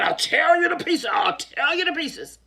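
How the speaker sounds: loud angry threat. Acted. Lion threatens into well.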